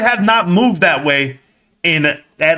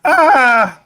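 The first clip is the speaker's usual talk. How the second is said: surprised exclamation; 10 semitones higher, 4 dB louder than usual. Real, not acted. Stock hits highs at close.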